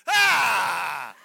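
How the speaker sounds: loud alarmed yelp; doctor's shocked shout. Retold or imitated. Doctor hearing he flew from Italy, loud shocked yelp. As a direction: blurt a shocked ah. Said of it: "She says".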